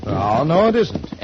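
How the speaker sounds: usual speaking voice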